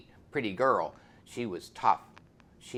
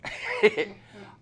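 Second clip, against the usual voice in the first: a short laugh much higher; high amused laugh; 8 dB louder than usual. Retold or imitated. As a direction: let out a quick high laugh. Woman laughs at preacher.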